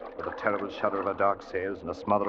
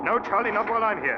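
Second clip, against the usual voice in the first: pleading refusal; 13 semitones higher than usual. Acted. Brother refusing to leave.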